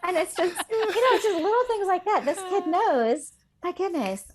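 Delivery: talking through laughter, high and loud; real laughter spilling into the words; laughing. Real, not acted. Teacher tickled by her pupil's trick, laughing.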